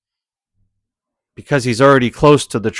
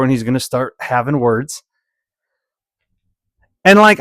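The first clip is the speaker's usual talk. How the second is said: normal talking voice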